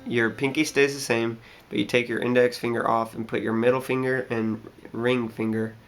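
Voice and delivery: usual speaking voice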